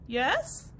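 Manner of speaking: plain reading voice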